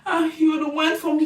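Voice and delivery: high whiny mock baby voice. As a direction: whimper it like a drunk suitor. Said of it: "And then he go".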